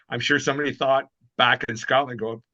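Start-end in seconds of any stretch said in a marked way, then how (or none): none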